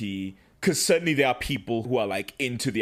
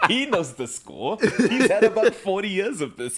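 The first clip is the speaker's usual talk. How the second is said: higher, louder joking through laughter; laughing; 8 semitones higher, 4 dB louder than usual. Real, not acted. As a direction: laugh it off, defending him. Joking defence of the actor.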